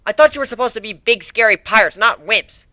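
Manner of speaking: put-on guard taunts the pirates for sending a girl; mocking. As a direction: sneer at the pirates, playground mockery. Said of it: "the guard mocked".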